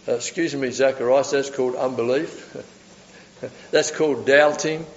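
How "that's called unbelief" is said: ordinary talk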